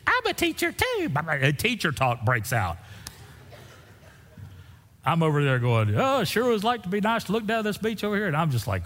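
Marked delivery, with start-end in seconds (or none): 0.1-1.1 very high mock squeal of delight; gushing stranger. Mimicking stranger's gushing discovery of common ground. 5.9-8.3 high, hammed-up hinting to move along; mock wistful hinting. Acting his bored wish to escape.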